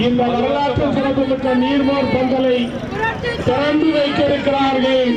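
high, fervent political announcer; rally speech. Acted. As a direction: hype up the crowd like a party rally announcer. Hyping the leader's stall opening.

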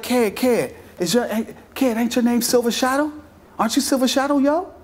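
high mock New York street voice; imitating New York accent. Two New York fans stopping him at the bus, mock New York accent. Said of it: "they had New York accents".

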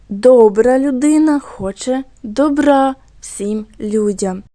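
slow, first "Добра" stressed on ДО, second "добра" on РА; first "Добра" as ДОБ-ра, then "добра" as доб-РА; contrasting stress. Shown as a demonstration. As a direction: read slowly, moving the stress from ДОБ-ра to доб-РА. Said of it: "ми змінили наголос і змінилось значення слова".

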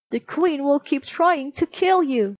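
high-voiced, earnest warning; serious dwarf. A narrator's reading. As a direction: caution her as a worried little dwarf. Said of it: "The dwarfs looked serious".

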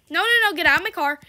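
fast, loud no no no at a stranger; yelling. Real, not acted. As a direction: shout her out of your car, quick. Yelling at a player in game.

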